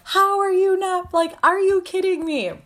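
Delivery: high-pitched, incredulous mock fury; acted pissed-off wail; exasperated outrage. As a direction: squeal it in retold disbelief. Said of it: "that almost pissed me off more 'cause I'm like".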